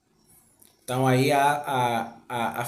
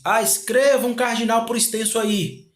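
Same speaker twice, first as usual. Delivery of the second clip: voiced exam instruction; 8 semitones higher, 4 dB louder than usual. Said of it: "Quando se diz".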